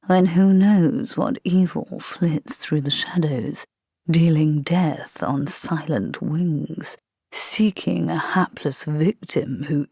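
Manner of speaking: slow, hushed horror-story voice; spooky storyteller. Acted. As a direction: draw it out to give him the creeps. Vampire spooks corporal with horror talk.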